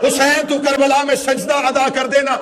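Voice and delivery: high, loud, impassioned mourning oratory; full-force lament preaching. Real, not acted. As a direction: cry out the tragedy to a grieving crowd.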